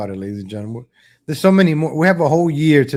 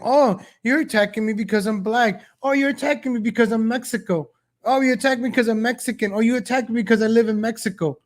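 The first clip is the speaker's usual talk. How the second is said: high whiny mock victim voice, pitched up; mocking a politician; 7 semitones higher than usual. Host mocking politicians who hide behind race, high whiny voice. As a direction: whine like a politician playing the victim. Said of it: "politicians that if you attack them".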